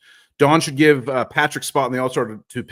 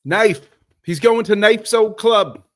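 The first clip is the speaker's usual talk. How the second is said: a horrible, hammed-up English accent, higher and louder; mock the midfielder's English accent badly; 8 semitones higher, 7 dB louder, slower than usual. Host mocking the midfielder's English accent, hammed-up and high. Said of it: "that's a horrible Westwood accent".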